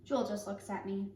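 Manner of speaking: usual speaking voice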